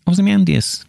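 bright high Ozymandias with a drop; normal take. Shown as a demonstration. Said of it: "that'd be normal".

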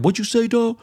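mock rival challenge. Retold arena quarrel, rival replies.

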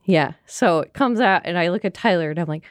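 usual speaking voice

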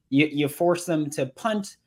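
normal talking voice

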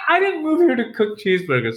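high-pitched exasperated objection; mock protest. Chef refusing locals' menu advice, high-pitched protest.

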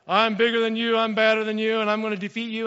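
loud, boastful giant taunt; Goliath. Acted. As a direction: boom it out like a challenger sizing up an army. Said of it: "comes out like".

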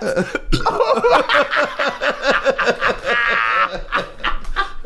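high wheezing laugh; crying with laughter; helpless laughter. Real, not acted.

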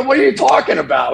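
loud, high, incredulous retort; mock-outraged yell at a fan; indignant shout. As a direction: fire it back in disbelief.

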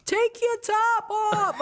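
hammed-up heckler, very high; heckler repeated. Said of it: "It was always like".

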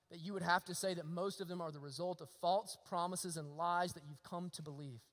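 normal talking voice

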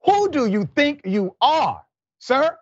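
venting in disbelief, voice shooting up; outraged. Real, not acted. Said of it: "I just needed to say that, get it off my chest".

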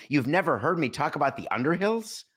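ordinary talk

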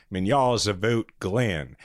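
put-on flat American accent on Russian, drawn out slowly; mock a classmate's unaccented American Russian. Podcaster imitating a Kansas City classmate, thick American accent in Russian. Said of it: "didn't even attempt a Russian accent".

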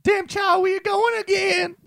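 very high hammed-up Madea squeal; Medea impression. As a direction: screech it like Madea scolding the kids. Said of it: "should I sing one of the [name] songs as Medea?".

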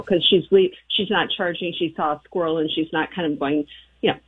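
ordinary delivery

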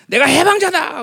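acted high, loud liberator's cry; shouted proclamation. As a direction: shout it out as a self-declared liberator. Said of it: "그러면 소리를 지른단 말이야".

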